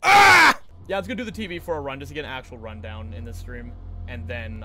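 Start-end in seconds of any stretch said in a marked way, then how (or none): 0.0-0.6 loud, high yell of annoyance; frustrated shout. Real, not acted. Streamer shouting as the speedrun skip fails. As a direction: yell out at the mistake.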